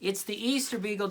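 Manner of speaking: ordinary delivery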